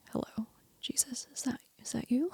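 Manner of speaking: soft unsure whisper; tentative whisper. Retold or imitated. In the pew, asking Jesus if he's real, whispered. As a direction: whisper it as if afraid to ask. Answering Jesus in her head.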